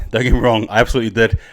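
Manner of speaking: ordinary talk, nothing marked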